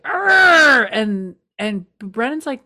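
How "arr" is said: loud frustrated arr; mock angry roar; angry growl. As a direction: growl it out like a fed-up boss. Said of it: "And she's like".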